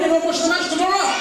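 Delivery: usual speaking voice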